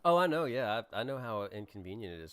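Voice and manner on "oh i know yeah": chewing ice while talking; mouth full of ice. Real, not acted. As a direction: speak around crunching ice. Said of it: "I'm gonna chew my ice".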